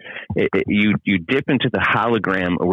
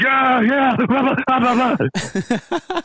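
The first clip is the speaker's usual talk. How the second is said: much higher, squeaky mock babble; mocking chatter; much slower than usual. Mocking what people say, high mocking babble. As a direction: parody their nagging as noise. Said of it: "all these people are like".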